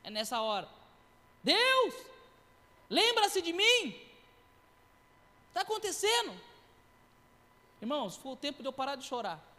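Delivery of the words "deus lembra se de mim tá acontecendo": much higher, louder pleading cry; desperate prayer shout, retold; crying out to God. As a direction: plead with God, slowing on lembra-se de mim.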